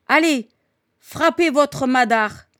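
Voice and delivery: rally the fighters loudly; urging battle cry. A narrator's reading. Old woman behind the combatants, loud urging cry.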